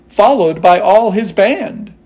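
usual speaking voice

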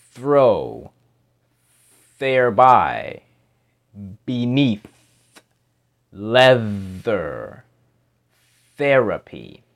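slow, separate th words with pauses between; careful teacher demo, one word at a time. Shown as a demonstration. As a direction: say each th word slowly so they can watch. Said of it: "slowly pronounce words so you can watch".

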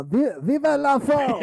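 high, loud hammed-up French cheer; mock French cheer. Co-host answering the request with a loud mock French cheer. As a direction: shout it like a cartoon Frenchman. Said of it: "I want to hear your French accent".

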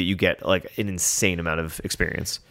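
ordinary delivery

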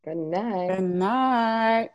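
usual speaking voice

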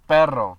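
perro stressed on its first syllable, PE-rro. Shown as a demonstration. Approving and modelling it for a friend, PE-rro. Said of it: "The emphasis is more on the E".

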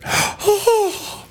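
high, hammed-up shocked gasp; mock gasp. Mocking the mom's outrage, a high hammed-up gasp. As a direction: gasp like a scandalized mother.

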